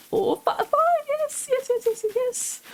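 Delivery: counting the map then bursting into happy squeals; freaking out. Real, not acted. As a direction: squeal with real joy. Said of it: "I'm freaking out right now".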